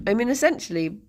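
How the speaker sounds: usual speaking voice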